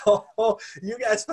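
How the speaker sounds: a cocky servant gloating through laughter. Retold or imitated. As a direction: laugh at them, now safe.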